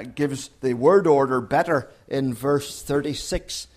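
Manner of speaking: ordinary talk, nothing marked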